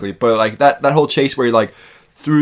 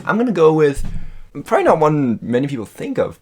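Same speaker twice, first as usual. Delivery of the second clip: ordinary delivery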